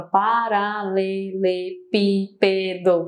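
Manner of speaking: "paralelepípedo" drawn out beat by beat; split into syllables. Shown as a demonstration. Teacher shows students the impulses, "paralelepípedo" syllable by syllable. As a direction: pronounce each syllable of "paralelepípedo" separately and evenly. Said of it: "Olha quantos impulsos eu tenho na palavra".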